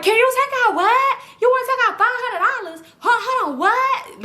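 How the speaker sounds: very high-pitched, hammed-up teller voice; mock a teller broadcasting private business in a high squawk; loud indiscreet teller. Teller blurting a customer's withdrawal to the bank, loud mocking imitation.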